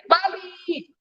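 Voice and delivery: high, drawn-out mock shout; retold shout. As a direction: call out high without much volume. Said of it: "Tuhan Yesus berteriak".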